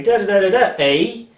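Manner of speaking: mock a TV Canadian accent with a bouncy rhythm; hammed-up Canadian accent. Shown as a demonstration. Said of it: "a bit like kind of going".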